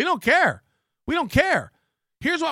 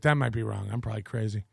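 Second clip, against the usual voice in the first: trailing sarcastic mumble; 12 semitones lower, 7 dB quieter, faster than usual. Real, not acted. Sarcastic self-mockery, fading out.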